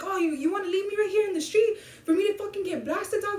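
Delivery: high, agitated mock outrage; indignant protest. Brother protesting being left in the street, retold as indignant. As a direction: imitate him arguing in disbelief.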